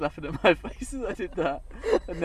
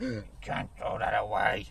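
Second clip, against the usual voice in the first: Rich Hall impression; 6 semitones lower than usual. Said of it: "it's more like Rich Hall".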